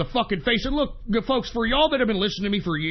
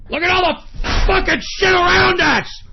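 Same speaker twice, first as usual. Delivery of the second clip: higher, louder enraged yell; angry shout; 9 semitones higher, 6 dB louder than usual. Acted. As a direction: bellow it in outrage. Ranting about the state of things.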